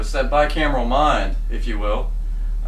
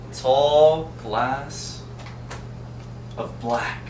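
slow dramatic ad read; 3 semitones higher, 3 dB quieter, much slower than usual. Acted. Soft drink ad punchline.

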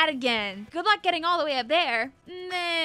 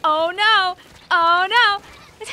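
loud "oh no" in dismay; 3 semitones higher, 11 dB louder, slower than usual. Real, not acted. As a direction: cry out after a flub. Embarrassed loud reaction.

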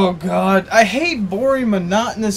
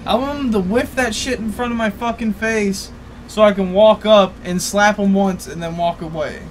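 ordinary delivery

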